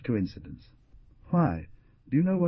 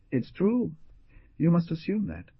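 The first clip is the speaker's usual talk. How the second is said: plain reading voice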